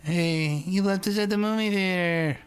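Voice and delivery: put-on high-pitched nine-year-old complaining; high kid voice. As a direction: squeak it out like a little kid. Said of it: "'Cause I talked like that when I was nine".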